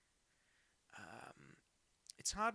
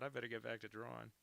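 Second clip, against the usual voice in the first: usual speaking voice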